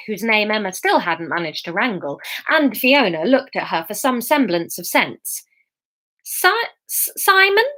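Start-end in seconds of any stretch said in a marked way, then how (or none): 6.2-7.7 high, halting, uncertain question; stammering. A narrator's reading. Emma tries to explain to Fiona, stammering. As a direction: struggle to get the name out. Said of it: "was all Emma managed to get out of her mouth".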